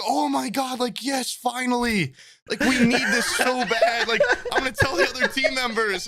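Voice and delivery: put-on high, eager girly voice with a laugh; excited girl. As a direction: gush like a relieved classmate. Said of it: "she's like".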